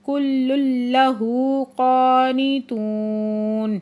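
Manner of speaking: slow chanted tajweed recitation; Quran recitation. Acted. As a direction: draw out each phrase as in tilawat. Teacher recites the verse phrase by phrase.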